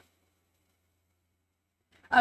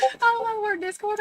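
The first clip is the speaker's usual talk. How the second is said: laughing; 12 semitones higher, 4 dB louder than usual. Real, not acted. Laughing after a scare.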